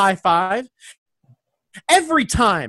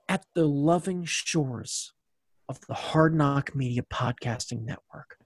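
dramatic announcer; 7 semitones lower than usual. Pretend. Hammed-up low announcer.

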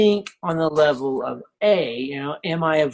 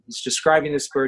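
ordinary talk

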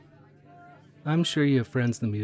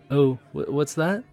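ordinary delivery

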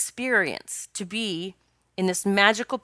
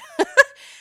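burst of laughter; 11 dB louder than usual. Real, not acted. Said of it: "laughs at the quote".

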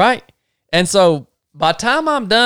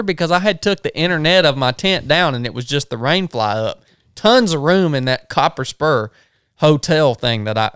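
ordinary talk

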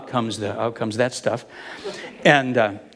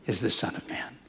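breathy whisper, very soft; hushed reveal; 9 semitones lower, 11 dB quieter than usual. Retold or imitated. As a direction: let the words fall to a whisper. Jesus reveals himself.

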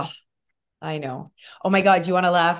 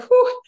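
high, loud "whew"; a shocked high whew from the doctor; high loud whistle-like whew; mock whistle of alarm; 5 dB louder than usual. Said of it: "She's like".

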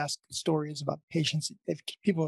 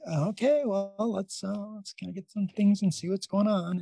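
imitating unrushed staff, a little higher; unhurried; 4 semitones higher than usual. ER team facing a dying patient, mimicked as unhurried. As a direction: lightly mimic someone in no rush at all. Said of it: "they seemed unrushed. They seemed unhurried".

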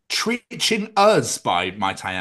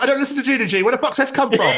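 high baffled outburst; 12 semitones higher, 4 dB louder, faster than usual. Retold or imitated. Baffled at own thoughts.

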